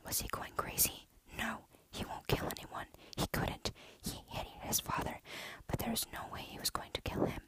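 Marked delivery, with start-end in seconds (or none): none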